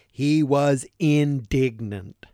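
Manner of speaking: "He was indignant" drawn out slowly and weighted; slow emphasis. Shown as a demonstration. Preacher stressing Jesus' anger to the congregation, slow and weighty. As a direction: land every word of "He was indignant" slowly. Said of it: "focus on one word therein".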